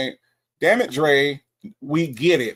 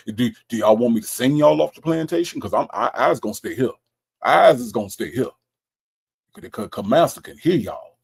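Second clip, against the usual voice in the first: mock plantation slave; 2 semitones lower than usual. Slave who is gonna stay here, mock timid dialect. Plays slave staying behind.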